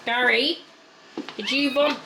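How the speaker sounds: ordinary talk, nothing marked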